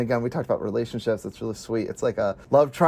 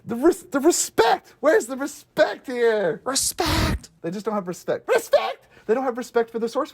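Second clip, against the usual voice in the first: much higher, slowed indignant cry of respect; mock outrage; 11 semitones higher than usual. Real, not acted. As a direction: demand respect like a wronged fan. Ranting about show disrespecting source.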